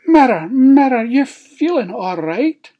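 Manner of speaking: loud, high, incredulous queen; affronted queen. Acted. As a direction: question the mirror in disbelief. Queen challenges the mirror's answer.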